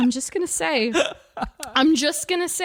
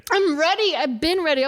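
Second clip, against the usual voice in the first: eager insistence; 5 semitones higher, 4 dB louder than usual. Real, not acted.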